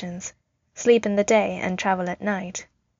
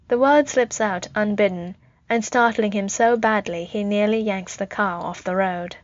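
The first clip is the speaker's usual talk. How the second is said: plain reading voice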